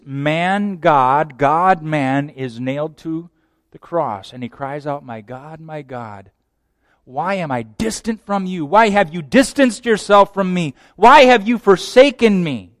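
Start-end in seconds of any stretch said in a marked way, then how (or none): 5.0-12.8 from hushed to a loud forsaken cry; anguished cry building. Acted. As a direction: cry out in growing anguish from the cross. Jesus crying out on cross.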